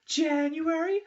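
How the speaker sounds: drawn-out high listing; slow high counting. Retold or imitated. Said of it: "They're like".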